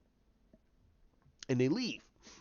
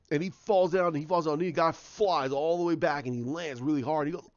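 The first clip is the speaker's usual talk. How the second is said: ordinary talk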